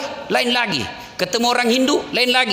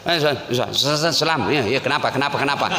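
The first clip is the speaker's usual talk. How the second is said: hurried phone answer; 6 semitones lower than usual. Retold or imitated. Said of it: "kalau terima telepon, nggak ada yang sempurna".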